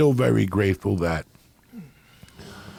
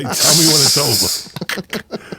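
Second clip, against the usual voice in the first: slow, higher and louder put-on old drunk; Johnny's voice; 5 semitones higher, 5 dB louder, slower than usual. Johnny hands his government check to the bartender, slow, loud, higher mock voice. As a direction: drag it out like a fried old regular.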